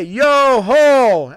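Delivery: loud hearty yo ho; loud pirate call. Acted. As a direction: belt it like a pirate on deck.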